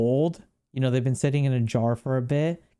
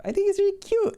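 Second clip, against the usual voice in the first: put-on much higher squeaky baby talk; cute baby voice; faster than usual. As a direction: coo it like a baby over a toy. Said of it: "I don't know why I'm talking like that".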